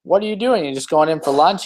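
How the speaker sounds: a hint of a keen tourist pitching an idea; eager businessman. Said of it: "and he goes".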